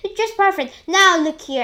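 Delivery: high, loud delight; cried out. A narrator's reading. An aunt delighted with the peach, cried out. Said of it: "she cried".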